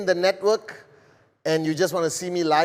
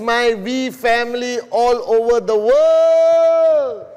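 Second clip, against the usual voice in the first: higher, louder, slow drawn-out welcome; hype greeting; 7 semitones higher, 6 dB louder, slower than usual. Acted. Leader greeting his worldwide network at broadcast start, big slow hype. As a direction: call out to the whole world like a stage opener.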